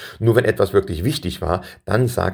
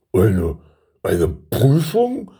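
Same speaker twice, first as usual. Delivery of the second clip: slow, stuttered, rising question; taken aback; much slower than usual. A narrator's reading. Son learning his father will test him, taken aback. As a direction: repeat it in puzzled disbelief.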